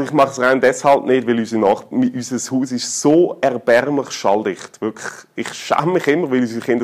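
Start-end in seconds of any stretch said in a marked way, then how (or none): none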